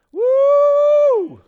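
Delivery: high, loud whoop of joy; excited woo. Real, not acted. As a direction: let out a big celebratory whoop. Excited cry after reading.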